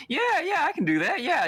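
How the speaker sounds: high-pitched, over-willing agreement; falsely agreeable Whisper. Acted. Player voices Whisper agreeing while shaking his head, high and unconvincing. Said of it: "when he's, like, saying".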